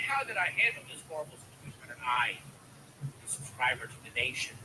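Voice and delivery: ordinary talk, nothing marked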